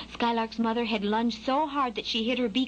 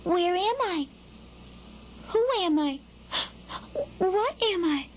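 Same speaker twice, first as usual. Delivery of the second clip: put-on high-pitched curious baby bird; little hatchling piping up, each question lifting high; small, high chick voice; 9 semitones higher than usual. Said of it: "and he said".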